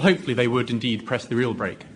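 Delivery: ordinary talk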